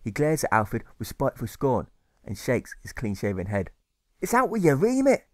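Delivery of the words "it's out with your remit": high, loud bellow. A narrator's reading. The carer bellows at Alfred. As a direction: roar it out at the meddling boy. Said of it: "he bellows with dread".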